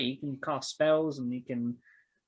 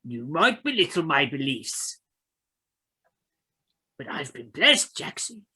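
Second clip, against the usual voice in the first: emphatic, louder believer's declaration; fervent Charlie; 4 semitones higher, 6 dB louder than usual. Acted. Charlie defends the blessing to Jackson, fervent and emphatic. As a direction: declare your faith with rising force.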